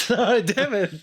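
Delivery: laughing, high and flustered. Real, not acted. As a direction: laugh through the words, embarrassed. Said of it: "All these expectations".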